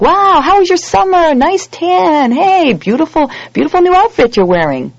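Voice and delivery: high, loud gushing chit-chat; mock small talk. Oblivious people chatting at synagogue, gushing mock small talk. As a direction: gush about summer tans and outfits. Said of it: "It's just like".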